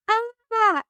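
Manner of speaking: very high, imitated "ah"; sudden high-pitched realisation. Finder's retold moment of realisation.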